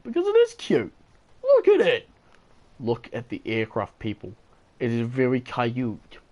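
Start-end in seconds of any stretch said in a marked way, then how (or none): 0.0-2.1 high, louder cry of delight over the plane; gushing. Real, not acted. Gamer defends his favourite plane to doubters, gushing and high. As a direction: insist it's adorable, voice jumping up.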